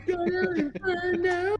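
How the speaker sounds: high-pitched playful cheer; battle cry. Acted. Player cheering on the torch fire, high mock battle cry.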